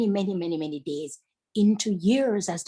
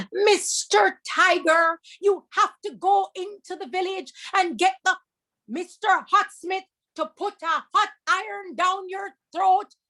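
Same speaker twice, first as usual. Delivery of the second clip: put-on high, bright little girl voice, firm no; a much higher girl's voice turning him away; young girl refusing; 8 semitones higher than usual. Daughter refusing the tiger at the door, high and firm.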